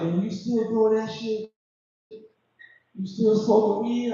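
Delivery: high, slow put-on friend voice; homeboy judging him for smoking weed; judgy friend voice. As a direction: sound shocked and judging. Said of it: "he was like".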